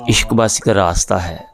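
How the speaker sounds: measured ghazal recital with held pauses; poetry recitation. Acted. Poet recites his teacher's ghazal at the literary meet, measured and deliberate. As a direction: lay out each line for the gathering.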